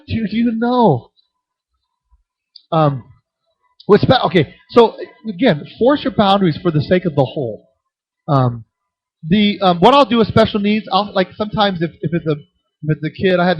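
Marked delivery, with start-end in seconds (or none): none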